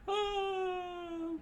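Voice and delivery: high cry of falling crew; high scream. Acted. Crew sliding down the deck, screaming. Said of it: "ship screamed as they".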